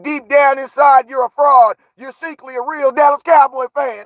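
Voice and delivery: loud, high quote of Grandpa's words; a hyped quote of grandpa's line; quoting Grandpa. Retold or imitated. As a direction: shout Grandpa's verdict out high. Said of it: "what did Grandpa say".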